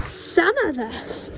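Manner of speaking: high, slow, lightly throaty; throaty witch voice. Acted. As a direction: lean in and hint darkly. Witch grandma revealing powers.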